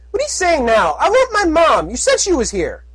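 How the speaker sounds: high, pleading filly shout; young filly voice. Acted. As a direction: shout like a frantic little filly. Filly character voice.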